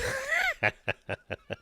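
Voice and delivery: high-pitched burst of laughter. Real, not acted. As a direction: squeal with laughter. Cat reaction.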